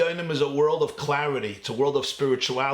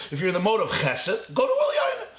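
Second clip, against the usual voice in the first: laughing mock; 7 semitones higher than usual. Mocking options, laugh heard.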